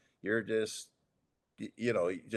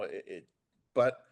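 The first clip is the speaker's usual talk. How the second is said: ordinary talk, nothing marked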